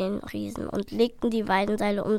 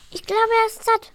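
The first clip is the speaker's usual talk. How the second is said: plain reading voice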